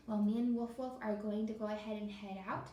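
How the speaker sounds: normal talking voice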